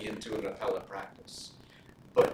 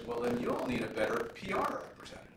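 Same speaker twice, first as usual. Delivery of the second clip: a hint of the senator, pitched higher; imitating the senator; 10 semitones higher, 4 dB quieter than usual. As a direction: lightly mimic his wry retort. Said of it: "That's how he said it".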